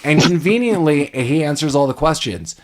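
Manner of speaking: normal talking voice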